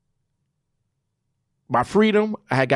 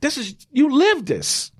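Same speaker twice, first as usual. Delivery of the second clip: son's voice; 10 semitones higher than usual. Retold or imitated. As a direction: voice the son getting more excited. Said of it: "My son is like".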